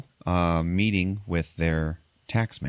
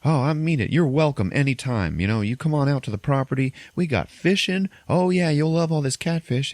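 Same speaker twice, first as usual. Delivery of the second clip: high-pitched imitation of a hospitable farmer; eager farmer; 8 semitones higher than usual. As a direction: gush an invitation as the farmer. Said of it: "the farmer guy is like".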